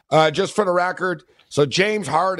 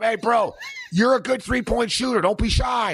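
excited encouragement; 6 semitones higher than usual. Pretend. Imagined heckle encouragement.

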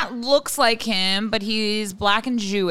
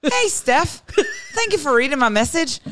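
put-on Irish accent; 3 semitones higher than usual. Host reads listener email in dad's accent, Irish accent. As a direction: read it out in a hammed-up Irish accent. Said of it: "So you just speak an Irish accent".

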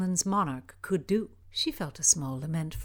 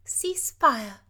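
command; 5 semitones higher, 3 dB louder than usual. Acted. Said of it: "Cecilia commanded".

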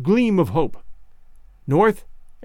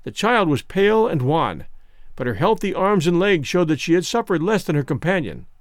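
plain reading voice

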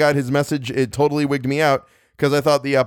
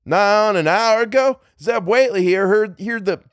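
higher, slow backwoods farmer dialect; old hill farmer telling of the night, in dialect; rural dialect; 9 semitones higher, slower than usual. Acted. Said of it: "I'm gonna one-shot the dialect".

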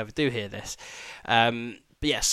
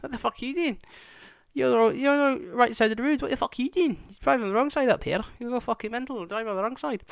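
high, put-on shouty policeman voice; mock copper; 11 semitones higher than usual. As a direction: lampoon the copper, shrill and indignant. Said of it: "he's there, like".

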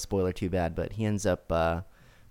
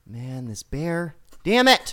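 slow start, then burst out much higher and louder on "damn it"; mock exasperation; 7 semitones higher, 5 dB louder, slower than usual. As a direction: fake frustration at being moved by a cartoon bear. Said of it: "I'm thinking".

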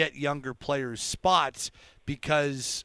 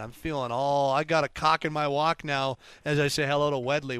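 ordinary talk, nothing marked

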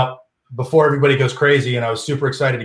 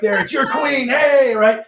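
showman shout; 11 semitones higher, 3 dB louder, slower than usual. Retold or imitated. Revealing the trick to a crowd.

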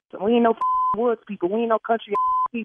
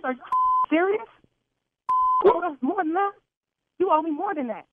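outraged; 7 semitones higher than usual. Real, not acted. Caller outraged at prank.

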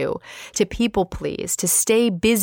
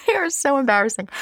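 higher, laughing and exaggerated; laughing emphasis; 7 semitones higher than usual. Real, not acted. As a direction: ham up the embarrassment with a laugh. Repeats line with emphasis.

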